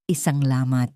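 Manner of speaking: ordinary delivery, nothing marked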